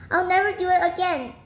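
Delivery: high, quick pleading promise; storybook cry of repentance. A narrator's reading. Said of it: "cried Mister Gregg".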